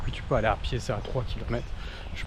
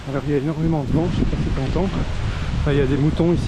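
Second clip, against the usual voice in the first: raised voice against strong wind; shouting over wind; 3 semitones higher, 10 dB louder than usual. Real, not acted. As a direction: talk loudly over gusts. Windy hilltop, asks if heard.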